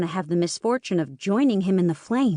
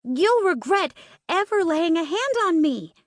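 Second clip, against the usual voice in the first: high furious yell, much higher; angry shriek; 10 semitones higher than usual. Acted. Captive girl threatening the twin holding her, angry shriek. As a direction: shriek it out in fury. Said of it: "My voice teeters into an angry shriek".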